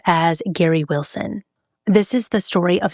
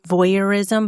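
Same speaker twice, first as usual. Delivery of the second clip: ordinary talk, nothing marked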